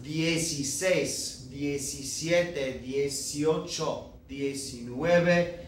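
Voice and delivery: "dieciséis, diecisiete, dieciocho, diecinueve" each said slowly and clearly; slow model Spanish teen numbers; careful slow pronunciation. Shown as a demonstration. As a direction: lay out each syllable for the class. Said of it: "if you notice the way that it's pronounced".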